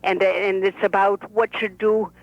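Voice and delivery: put-on lively old Brooklyn bubby; Bubby's voice. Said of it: "This is the Bobby talking".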